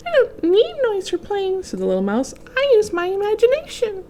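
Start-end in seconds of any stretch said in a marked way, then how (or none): 0.1-1.6 very high, tiny mouse voice; a tiny mouse explaining calmly; little mouse voice. Acted. Mouse character speaks. 2.6-4.0 very high, tiny mouse voice; a tiny mouse explaining calmly; little mouse voice. Acted. Mouse character speaks.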